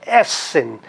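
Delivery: essen, stressed on the opening e; stress on first syllable. Shown as a demonstration. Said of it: "tá no E".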